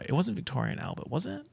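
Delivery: normal talking voice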